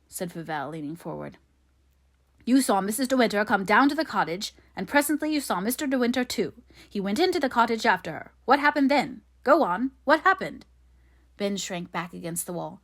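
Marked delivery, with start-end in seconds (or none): 2.5-10.6 loud, high, urgent interrogation; aggressive pressing. A narrator's reading. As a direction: drive relentlessly towards the answer. Favell presses the witness.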